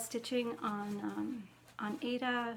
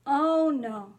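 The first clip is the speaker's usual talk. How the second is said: loud, dismayed oh no; dismayed cry; 3 semitones higher, 12 dB louder than usual. Real, not acted. Seeing the spider button, loud dismayed cry. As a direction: blurt it out in horror. Another spider detail appears.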